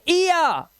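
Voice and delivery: "ईया" high and drawn out; ee-ya sound. Shown as a demonstration. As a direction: stretch "ईया" from ee into ya. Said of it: "ये क्या sound हो गया".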